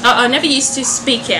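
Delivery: ordinary delivery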